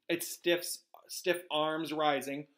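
plain reading voice

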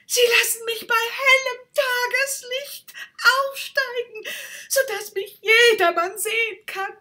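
very high, loud, near tears; overjoyed to be seen; ecstatic rocket. Acted. Rocket on the fire rejoices it will rise in daylight.

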